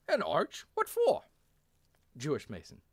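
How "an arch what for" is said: put-on high, loud German-accented question; baffled German captain, pitch leaping up. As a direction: ask it incredulously in a German accent. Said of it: "Captain York in German".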